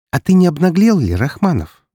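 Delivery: sneering with a smile; mocking. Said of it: "улыбнулась она с явной издёвкой".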